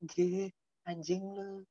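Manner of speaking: soft, drawn-out Javanese cursing; hushed mock Javanese insult; imitated Javanese speaker. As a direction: curse slowly and quietly, Javanese-style. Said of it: "orang Jawa juga".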